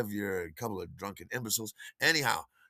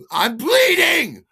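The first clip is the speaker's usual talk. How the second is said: very high, loud furious shriek; acted drunken rage at full pitch; screaming; 13 dB louder, much slower than usual. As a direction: scream it in hysterical outrage. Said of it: "I'm just screaming at him".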